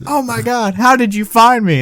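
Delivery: high-pitched, hammed-up disbelief; mock astonishment. Host retells film character being found, mock astonishment.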